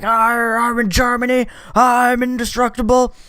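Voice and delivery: high, mock-invincible dad voice; twenty-one-again dad. Drunk dad back in Germany feeling twenty-one, high mock bravado. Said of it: "like, twenty-one again, and he was like".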